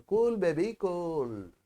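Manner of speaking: slow, soft, soothing mantra; calm yourself down slowly, word by word; soothing self-talk. Shown as a demonstration. Preacher demonstrating the calming mantra to himself, slow and soft. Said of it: "ఎవరికి వాళ్ళు చెప్పుకోవాలి".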